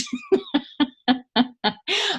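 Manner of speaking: high, bubbly burst of laughter. Real, not acted. Woman laughing at her own frantic story, high and bubbly. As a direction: laugh at yourself, high and giddy.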